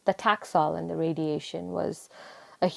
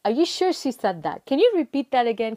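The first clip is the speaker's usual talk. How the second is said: excited disbelief; 6 semitones higher, 4 dB louder than usual. Retold or imitated. Disbelief at cancer-free news.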